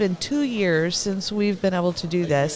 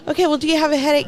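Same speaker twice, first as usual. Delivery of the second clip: imitating cashier; 8 semitones higher, 4 dB louder, much faster than usual. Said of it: "she's like".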